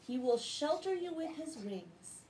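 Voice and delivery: ordinary delivery, nothing marked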